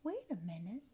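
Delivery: far-off whispered echo; faint echo. Acted. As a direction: echo it back very softly. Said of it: "we have an echo in here".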